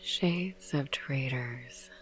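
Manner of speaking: slow, spooky whispered verse reading; hushed, eerie poetry reading; whispered poem recital. Acted. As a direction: savour each line like a ghost story.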